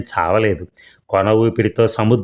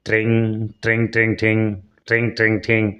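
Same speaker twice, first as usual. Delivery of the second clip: light, quick ringing sounds, voiced like a bell; phone ring imitation. A narrator's reading. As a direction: mimic a telephone ringing.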